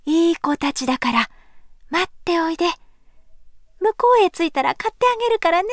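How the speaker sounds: gentle coaxing mother; soothing a child. A narrator's reading. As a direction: coax the children kindly. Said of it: "言って聞かせても".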